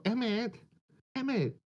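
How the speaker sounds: high squeaky little voice. Retold or imitated. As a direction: squeak the name out, small and high. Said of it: "She has that voice, that squeaky little voice".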